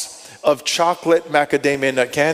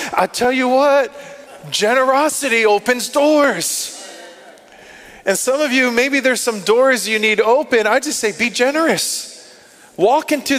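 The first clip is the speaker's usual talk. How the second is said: pitched-up sermon exhortation, pressing the point; rising preaching; 6 semitones higher than usual. Acted. Pastor urging the congregation to give, in a rising preaching voice. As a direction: preach it higher, urging the crowd.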